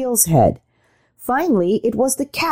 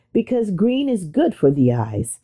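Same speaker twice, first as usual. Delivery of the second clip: ordinary delivery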